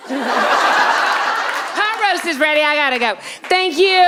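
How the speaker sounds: bright, loud housewife sign-off; mock cheery exit; chirpy housewife.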